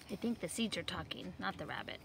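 ordinary delivery

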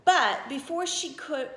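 plain reading voice

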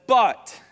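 But, punched loud and high; emphatic BUT. Acted. Jesus answering the accusers, a punched, loud But. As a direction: land hard on But.

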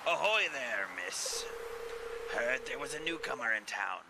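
lightly voiced salty pirate; a hint of an old sea dog. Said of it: "He's a pirate, see?".

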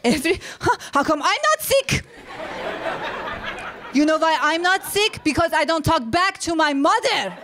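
indignant mother. Retold or imitated. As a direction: argue back like an affronted immigrant mom. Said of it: "She's like".

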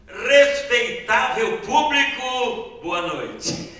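slow, drawn-out circus announcer call; ringmaster greeting. Shown as a demonstration. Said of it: "vou cumprimentar vocês da maneira que eu aprendi".

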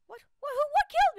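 startled yelp that cracks high and loud; squeaky cracked outburst. Real, not acted. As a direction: voice breaking up in a shocked shout. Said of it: "I got that voice crack".

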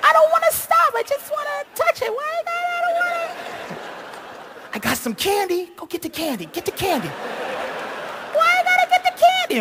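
put-on very high, coy little-girl voice; giggling girly voice. Boyfriend teasing her in a very high giggly girl voice. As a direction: play a giggly teen being coy. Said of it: "I'm, like, giggling".

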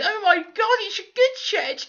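high, slow, gushing awe over a shed; mock rapture. Mock swooning over a shed, high and slow.

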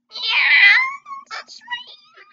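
put-on very high anime cat girl voice. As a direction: chirp it out like a cutesy cat girl. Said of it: "That's a cat girl voice".

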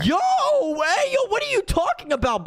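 high-pitched, startled protest; incredulous outburst. Pretend. Gamer reacts to weird Catnap girls, incredulous. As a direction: squawk it in mock disbelief.

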